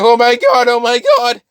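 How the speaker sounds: high, loud mock wailing; imitated crying. Mineta crying over the body, hammed-up wail. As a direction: wail it in acted panic. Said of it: "No, he's crying".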